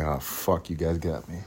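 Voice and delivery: low, soft admission; hushed pretend surrender; mock defeat. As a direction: give in quietly, dropping the pitch. Acts being caught by fake clip.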